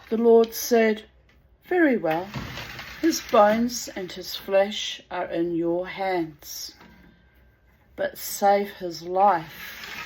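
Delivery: slow, loud, solemn Bible reading; scripture reading. Acted. Reading the Book of Job aloud to viewers, solemn. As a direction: intone it like a sermon.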